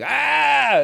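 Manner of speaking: put-on high, loud yelp of a toddler taking a tumble; child's cry from falling. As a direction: let out a sharp little fall cry. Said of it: "she falls and she's like".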